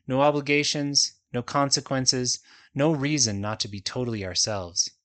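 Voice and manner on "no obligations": usual speaking voice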